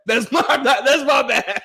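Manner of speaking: high, loud apology through laughter; laughing mock apology. Apologizing to someone he bumped, high laughing plea. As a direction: blurt a hammed-up apology, laughing.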